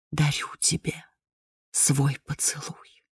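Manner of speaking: slow, breathless whisper of death; deathly whisper. A narrator's reading. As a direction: breathe it like a seductive spectre. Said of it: "прошептали алые губы". (шёпот смерти, медленно и беззвучно)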